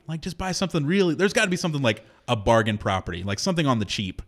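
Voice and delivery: ordinary talk, nothing marked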